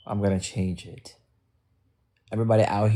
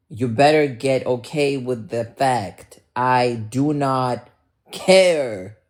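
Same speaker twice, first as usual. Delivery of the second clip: angry defiance; 3 semitones higher, 4 dB louder than usual. Real, not acted. Streamer telling critics they don't care, loud defiance. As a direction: lay it down forcefully, word by word.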